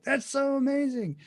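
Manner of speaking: high-pitched gush of wonder; acted amazement. Teacher voices delight at flowers coming out, amazed. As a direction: marvel at it in a lifted voice. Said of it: "a lot of, "Oh my God," moments".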